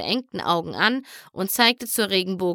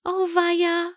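whispering; 9 semitones higher than usual. A narrator's reading. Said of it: "flüsterte Fluttershy".

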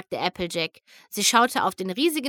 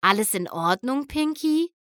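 mildly worried question, lifting high on the name; understated worry, rising at the end; 5 semitones higher than usual. Acted. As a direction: ask with a touch of growing concern. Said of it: "fragte Spike zunehmend besorgt".

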